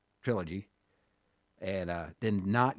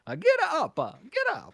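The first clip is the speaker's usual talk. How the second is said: mock Italian Mario; 6 dB louder than usual. Acting out Mario war scene.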